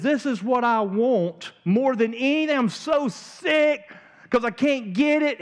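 preacher mocking Amnon's lovesick whining; whiny lovesick Amnon. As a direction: play him as a sulking spoiled prince. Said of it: "Suddenly the guy says".

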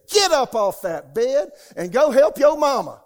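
the Holy Spirit ordering him off the bed, shouted; loud command. Retold or imitated. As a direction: thunder it down like a voice from heaven.

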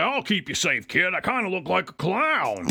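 goofy put-on robot hero voice; Turbo impression. Robot who caught the kid reassures him, hammed-up voice. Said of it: "he's like".

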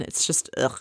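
voiceless gagging ugh of disgust. Real, not acted. Host grossed out by the couple's hug, disgusted voiceless ugh. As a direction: let a gag of disgust slip out. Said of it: "it gagged me".

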